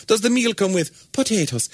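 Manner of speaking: high, singsong Irish question; Irish accent. Shown as a demonstration. Comedian demonstrating an Irish sentence to host, Irish accent. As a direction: ask it in a put-on Irish brogue. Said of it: "say sentences with the word potatoes".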